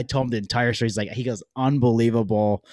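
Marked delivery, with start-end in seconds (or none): 1.5-2.6 big incredulous reaction, retold; retold amazement. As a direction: imitate an amazed store clerk. Clerk reacts to the story.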